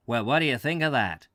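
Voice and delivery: put-on quick, eager American accent; the American tourist marvelling, fast. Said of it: "said the American".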